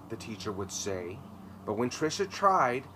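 plain reading voice

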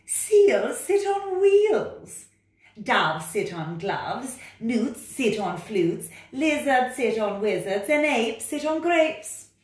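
loud, high, bossy cat voice. Acted. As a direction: order the frog about like a know-it-all cat. Cat character.

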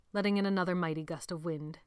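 usual speaking voice

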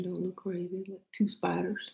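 ordinary delivery

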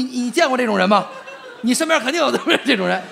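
ordinary delivery

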